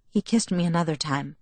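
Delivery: ordinary delivery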